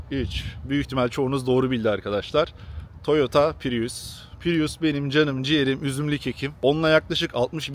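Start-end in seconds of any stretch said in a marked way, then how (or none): 3.0-4.3 very slow, stretched reveal; drawn-out reveal. Real, not acted. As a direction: drag out the name for effect. Reveals the car's name.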